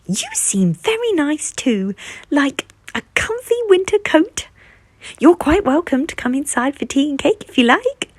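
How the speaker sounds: high, friendly goose voice; a cheerful high goose inviting the bear in; sweet goose voice. A narrator's reading.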